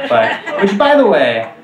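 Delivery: usual speaking voice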